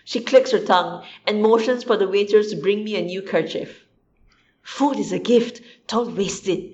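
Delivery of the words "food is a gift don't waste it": put-on soft, weary sigh of an old lady; a mild, tired grandma's sigh over wasted food. A narrator's reading. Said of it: "she sighs".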